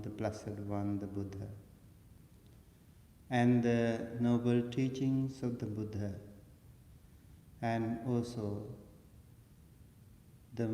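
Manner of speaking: hushed, reverent homage, softly spoken; solemn recitation. Real, not acted. Monk paying respect to the Buddha before teaching, quiet recitation. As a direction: recite it softly in measured phrases with long pauses, letting endings fade.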